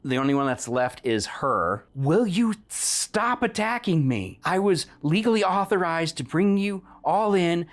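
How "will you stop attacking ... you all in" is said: high, pleading captive voice; pleading bounty hunter. Acted. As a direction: beg them to stop attacking you. NPC pleading with the party.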